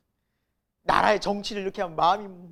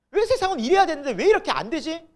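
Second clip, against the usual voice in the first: high, loud indignant complaint; retold anger; 8 semitones higher, 8 dB louder than usual. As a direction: re-enact railing at a world that won't comply. Acting out anger at the world. (화가 나서 따지는 말투를 재연하듯)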